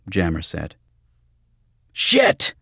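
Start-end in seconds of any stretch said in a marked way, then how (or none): 1.9-2.5 high-pitched explosive curse; outraged shout. Acted. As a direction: burst out in loud protest. Bobby jumps off bar in protest.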